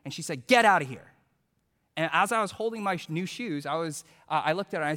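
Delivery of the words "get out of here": loud mock order to leave; short annoyed burst, acted out; imitated angry dismissal. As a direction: send them packing, retold with force. Angry seller dismisses him.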